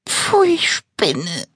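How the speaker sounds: seufzend. Acted. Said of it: "seufzt das Monster".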